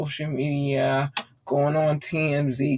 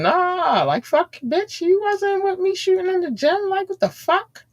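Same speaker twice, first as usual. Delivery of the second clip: very high-pitched, incredulous outburst; indignant squeal. Real, not acted. Indignant retort to a viewer's comment.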